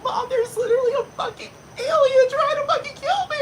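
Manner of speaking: very high, shrieking with laughter; panicked daughter. Retold or imitated. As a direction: scream it half laughing in fake terror. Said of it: "this bitch is like".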